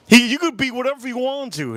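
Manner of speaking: high-pitched, exasperated insistence, imitated; mad counselor. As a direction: retell a counselor getting worked up and insistent. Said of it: "He got so mad, the counselor".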